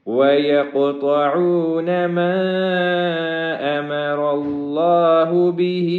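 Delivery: slow melodic Quran chant; chanted Quranic recitation, long and melodic; tajweed recitation. Shown as a demonstration. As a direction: draw each word out in sung recitation.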